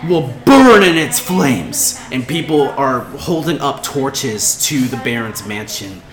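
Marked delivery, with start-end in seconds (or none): 0.1-2.0 slow, loud, drawn-out threat; menacing yell. Acted. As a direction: proclaim their doom to the crowd, slowly. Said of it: "she yells out to them".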